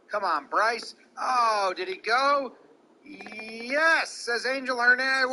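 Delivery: live play-by-play. Acted. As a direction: call the at-bat live, pitch by pitch. Live call of the at-bat.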